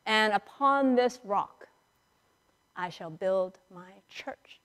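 ordinary delivery

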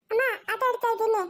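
put-on high childish voice; little child. Child answering mother in a high childish voice. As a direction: chirp it back like a small kid.

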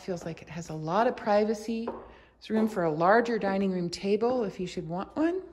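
ordinary talk

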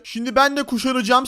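ordinary delivery

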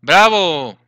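"¡Bravo!" called out loud with a falling cheer. Shown as a demonstration. Teacher showing students how to cheer, loud and proud. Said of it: "la utilizamos como para animar a alguien".

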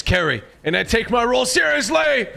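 high shouting anime hero; anime character voice. Acted. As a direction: declare it like a shonen hero. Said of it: "why do you sound like an anime character".

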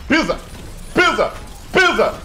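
loud high shouted commands; excited yelling. Real, not acted. Streamer urging himself to stomp, loud yells. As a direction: bark it repeatedly.

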